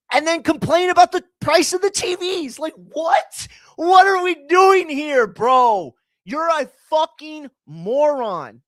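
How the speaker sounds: high, loud, incredulous shouting; a cracking near-scream of frustration; exasperated yell. Real, not acted. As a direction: yell it in disbelief at the absurdity. Ranting about boxing fans taking sides.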